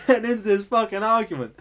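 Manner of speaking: plain reading voice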